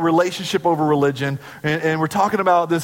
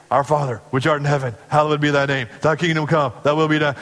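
rote recitation; faster than usual. Retold or imitated. Team reciting prayer by routine.

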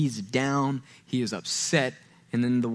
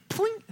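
mock ping sound effect. God flicking man away.

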